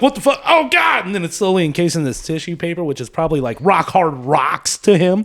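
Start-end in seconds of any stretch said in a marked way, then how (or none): 0.0-1.1 high squeaky bug panic; tiny bug freaking out, pitched way up; panicked bug voice. Retold or imitated. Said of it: "the bug's like".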